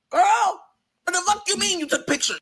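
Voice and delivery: high, incredulous squeal of "what the fuck"; shocked disbelief. Real, not acted. Outraged disbelief at friend's photos.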